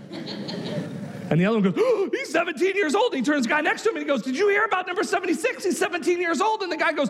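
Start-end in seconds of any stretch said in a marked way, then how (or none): 1.8-3.1 high, gasped schoolboy alarm; mock-horrified kid; acted shock. Boy shocked by the rumour. 4.2-6.6 high, urgent rumour-spreading; mock-horrified eighth grader; alarmed schoolboy. Boy passes the alarming rumour on.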